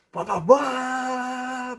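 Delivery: high drawn-out car noise; imitated engine revving. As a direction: mimic a revving engine, last note held long.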